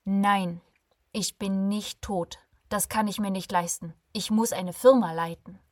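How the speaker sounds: loud, insistent interruption; cutting her off. Acted. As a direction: cut in sharply, refusing to accept it. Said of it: "Alexander schnitt ihr das Wort ab".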